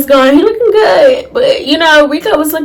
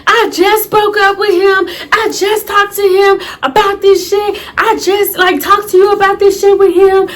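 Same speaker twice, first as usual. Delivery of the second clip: high-pitched retold tirade; going off; 6 semitones higher than usual. Confronting cousin who talked to her ex, going off. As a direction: rant at her in rising outrage. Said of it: "I started going off. I'm like".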